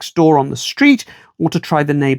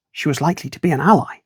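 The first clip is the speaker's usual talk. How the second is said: ordinary delivery, nothing marked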